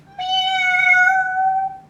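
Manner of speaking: long squeaky kitty meow; cat meow. Shown as a demonstration. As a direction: mew like a little cat. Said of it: "Cats all the time".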